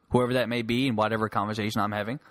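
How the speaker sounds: usual speaking voice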